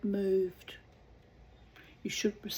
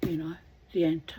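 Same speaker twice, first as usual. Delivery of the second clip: usual speaking voice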